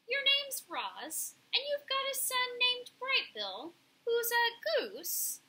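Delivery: high-pitched bird character, drawn out; curious pigeon. A narrator's reading.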